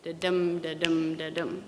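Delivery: "da-dum" chanted with the second beat stressed, fading on the last; iambic beat. Shown as a demonstration. Lecturer demonstrating the iambic beat to students, rhythmic chant. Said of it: "The underlying rhythm is iambic".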